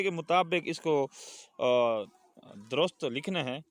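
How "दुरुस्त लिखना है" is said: ordinary talk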